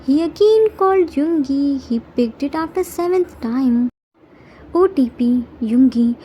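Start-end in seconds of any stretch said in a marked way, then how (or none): none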